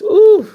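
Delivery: high wailing ooh of grief; crying out in grief; emotional cry. Real, not acted. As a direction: let out a high sobbing ooh.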